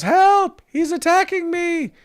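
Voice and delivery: high, shrill-pitched cry for help; high mock scream for help; mock victim yell. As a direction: scream it like a fake victim in a skit. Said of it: "she yells".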